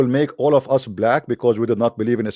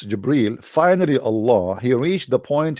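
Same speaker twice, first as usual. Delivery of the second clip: usual speaking voice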